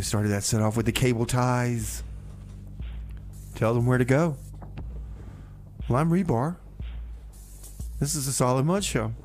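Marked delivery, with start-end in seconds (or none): none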